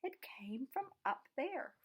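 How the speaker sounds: slow hushed whisper. A narrator's reading. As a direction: breathe it so no one hears. Beaky points to the song.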